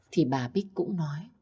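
ordinary delivery

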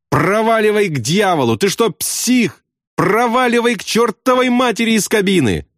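very high, furious cursing; exasperated outburst. A narrator's reading. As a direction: order him out in a frantic high voice. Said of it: "воскликнул Ясариан в мучительном изумлении".

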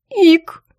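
high loud hiccup of shock; startled hiccup. A narrator's reading. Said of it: "hiccup of shock".

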